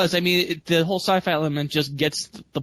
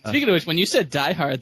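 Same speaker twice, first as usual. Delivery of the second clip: usual speaking voice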